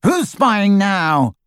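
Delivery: high, slow, angry shout; boy shouting at a hidden eavesdropper. A narrator's reading. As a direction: yell it out, caught someone spying. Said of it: "he shouted".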